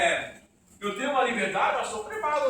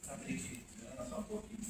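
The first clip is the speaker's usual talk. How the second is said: whispered tease; 9 semitones lower, 18 dB quieter than usual. Real, not acted. Teasing aside to congregation.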